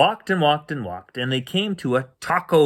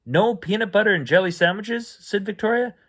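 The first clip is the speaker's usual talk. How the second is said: disbelief; 8 semitones higher, faster than usual. Acted. Child shocked by menu.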